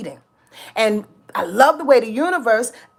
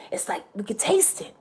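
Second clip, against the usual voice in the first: breathy hushed whisper; 2 semitones lower, 10 dB quieter than usual. Real, not acted. Telling listeners the change is near, whispered. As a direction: lean in and whisper it.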